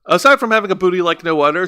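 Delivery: rattled off quickly; fast repeat. Retold or imitated. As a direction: rush through the echo.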